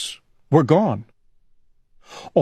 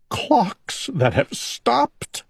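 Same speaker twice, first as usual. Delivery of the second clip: slow high weepy voice; crying; 9 semitones higher, slower than usual. A narrator's reading. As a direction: let the sadness drag it. Owl crying.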